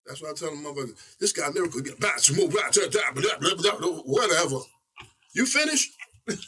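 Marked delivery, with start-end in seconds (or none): 1.2-4.6 higher, rhythmic mock rapping; mocking blah-blah. Rapper parodying wordy lyrical MCs, mocking singsong. 5.3-5.9 very high, rising mock query; dismissive question. Rapper interrupting the wordy MC he mimics, mock impatient.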